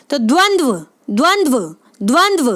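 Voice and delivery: द्वंद्व said with a clear v after each d; sounding out the word. Shown as a demonstration. Teacher saying द्वंद्व for students to repeat. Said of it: "बोल के देखें".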